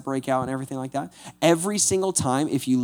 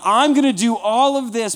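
God; 9 semitones higher, 6 dB louder than usual. Retold or imitated. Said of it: "So God's like".

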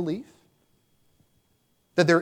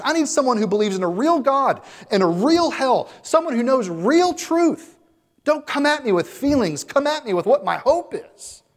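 higher, louder furious outburst; dying sinner shouting in anger, higher and louder; irate old man; 9 semitones higher, 4 dB louder than usual. Retold or imitated. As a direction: let the dying man explode at her nonsense. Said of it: "he becomes irate".

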